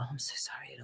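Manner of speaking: breathy hushed sympathy, no voice at all; whisper. Retold or imitated. Casting panel offering whispered condolences, hushed whisper.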